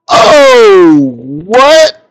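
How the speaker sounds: sudden high squeak of surprise; a sudden high-pitched "oh, what?"; startled surprise. Real, not acted. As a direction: jolt up high and loud, caught off guard. Surprised exclamation at chart.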